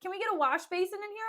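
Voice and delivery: high, loud mock complaint; hammed-up demanding call; mock tantrum. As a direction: imitate a master yelling for his servants. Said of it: "he'll turn and he'll be like".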